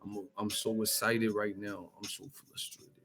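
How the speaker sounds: soft country boxer, mumbling; string the catchphrases together quietly; hushed mock country fighter; Wilder impression. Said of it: "That's how Wilder be talking".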